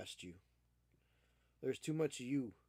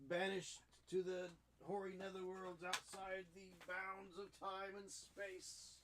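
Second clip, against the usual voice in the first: slow, hushed, theatrical doom voice; mock dramatic pronouncement; 4 semitones higher, 9 dB quieter than usual. Owner banishing his cat in a mock ominous voice. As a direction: proclaim it like an ominous wizard.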